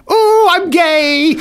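very high, drawn-out mock announcement; mocking camp squeal. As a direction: squeal it slowly, hamming it up. Prank text read out mockingly.

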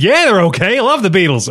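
high, eager mock gushing; imitated gushing delivery guy; overexcited fan. Excited fan echoes lukewarm reply.

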